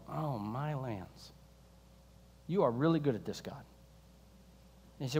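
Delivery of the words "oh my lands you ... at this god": awed hush. Retold or imitated. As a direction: breathe it out, stunned by the view. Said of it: "and you go".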